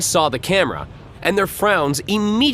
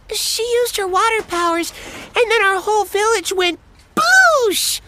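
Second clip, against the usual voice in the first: put-on much higher kid voice, louder at the end; excited little boy; 3 dB louder than usual. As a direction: blurt it out like a thrilled kid.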